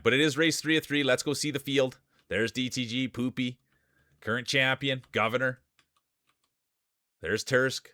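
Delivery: lively play-by-play calling the field; race announcer. Acted. Streamer calls the marble race field, announcer style. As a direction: call the race like a sportscaster.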